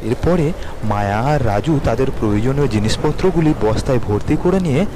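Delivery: ordinary delivery, nothing marked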